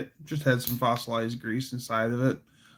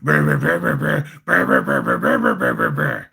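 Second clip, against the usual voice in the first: much lower, louder rumbling brr; garbled Batman growl; 13 semitones lower, 4 dB louder, faster than usual. Retold or imitated. Batman growling gibberish at the Joker, loud. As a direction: rumble it deep and fast. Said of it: "and he's".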